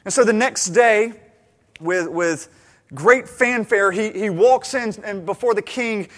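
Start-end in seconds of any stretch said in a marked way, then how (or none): none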